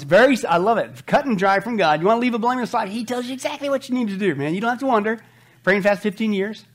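ordinary talk, nothing marked

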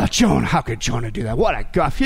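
mock dismay in a low voice; feigned disapproval; sarcastic. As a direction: tut over someone else's failure, sarcastically. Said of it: "sarcasm to prove a point".

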